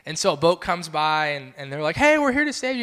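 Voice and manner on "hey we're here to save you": high, louder hail to a swimmer; imitated boatman's holler; rescuer calling out. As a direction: call out like a would-be rescuer. Rescuers in boat call to swimmer.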